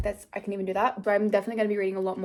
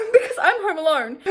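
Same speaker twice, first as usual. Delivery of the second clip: high, loud retold panic breaking into laughter; laughing panic; 12 semitones higher, 10 dB louder than usual. Vlogger retelling a scare at home, laughing mock panic. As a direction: squeal it in mock alarm, laughing.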